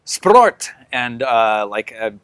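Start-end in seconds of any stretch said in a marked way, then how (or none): 0.1-0.7 high, loud spell shout. Acted. Game master voicing Elsa casting a spell, sudden shout. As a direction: shout it out like a magic command. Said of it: "Elsa sou-, shouts".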